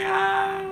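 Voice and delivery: very high, drawn-out "ah"; hammed-up high wail; mock overwhelmed squeal. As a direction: squeal as if overcome.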